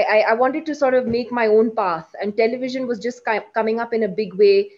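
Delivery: ordinary delivery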